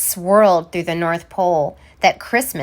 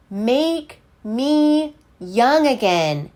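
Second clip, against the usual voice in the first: Santa's wish; 3 semitones higher, slower than usual. Acted.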